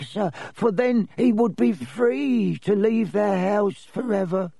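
high, squeaky little elf voice; high-voiced house elf explaining himself; Dobby the elf, earnest. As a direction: confess it in a high-pitched servile voice.

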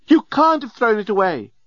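high-pitched, appalled outcry; screamed protest. A narrator's reading. Female alien protests to Leovinus, high mock scream. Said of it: "screamed the remarkably attractive".

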